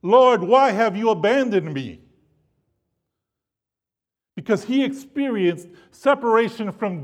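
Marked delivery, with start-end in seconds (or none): 0.0-2.0 retold anguished cry, pitched higher and louder. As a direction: cry out to God as if forsaken. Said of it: "he cries out".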